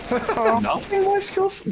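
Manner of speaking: high, slow, incredulous laugh; laughing disbelief. Real, not acted. Disbelief at harsh opinion.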